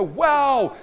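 loud, high "Wow!"; big exclamation of wonder; acted amazement. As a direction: burst out as if just discovering it. Re-enacts own amazement at scripture.

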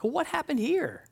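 finding a scratch on your car; mock surprise.

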